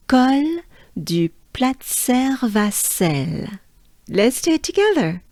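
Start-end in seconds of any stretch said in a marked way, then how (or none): 0.1-3.6 Col du Platzer Vassel, slow and higher, in French; French pronunciation. Shown as a demonstration. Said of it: "it sounds like this".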